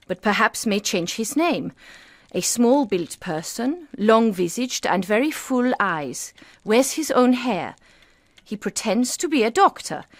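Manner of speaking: reading aloud. Acted. Wife reads newspaper notice aloud.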